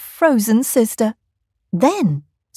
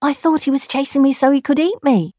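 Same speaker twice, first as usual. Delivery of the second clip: higher, fast indignant voice; put-on kangaroo voice; 6 semitones higher, faster than usual. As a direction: complain to the trickster. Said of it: "said Kangaroo".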